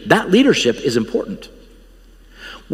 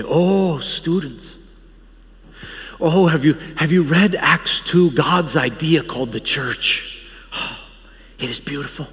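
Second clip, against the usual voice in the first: put-on lecturing professor with a French accent. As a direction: passionate old teacher, French accent. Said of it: "he's got this French accent".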